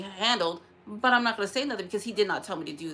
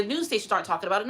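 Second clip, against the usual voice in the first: ordinary delivery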